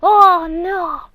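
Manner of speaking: loud, drawn-out horrified scream. Retold or imitated. Sibling seeing blood on brother's head, loud horrified scream. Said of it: "I scream so loud".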